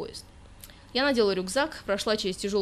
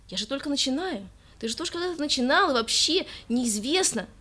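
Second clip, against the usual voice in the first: hurt; 6 semitones higher, 3 dB louder than usual. Acted. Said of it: "обиделась девушка".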